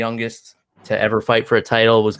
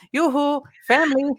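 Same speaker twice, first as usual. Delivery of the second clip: child mimicking Oaken. Daughter waking mom in her ear, high singsong mock yell. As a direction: imitate a kid's Norwegian shopkeeper call, pitched way up. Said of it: "yell in her ear".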